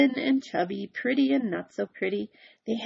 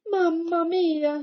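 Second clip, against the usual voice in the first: high, loud, slow wail; crying out in dismay; 8 semitones higher, 5 dB louder, slower than usual. Acted. Big Anthony finding the ring stuck, high loud wail. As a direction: wail it in despair. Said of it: "he cried".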